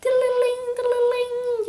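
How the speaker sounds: tiny high sing-song tinkle for tears; mild mock crying. Reader shows her small tears, as a high mock trickle. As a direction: trill it very high, held on one note. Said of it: "Not, like, sobbing hysterical, but just like".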